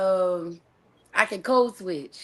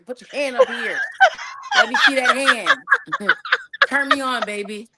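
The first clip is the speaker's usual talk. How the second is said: flirty; 11 semitones higher, 5 dB louder than usual. Acted. Flirting with a signing partner.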